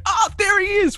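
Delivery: high-pitched excited recognition; excited exclamation. Retold or imitated. Viewer re-enacting pointing at the screen, high excited cry.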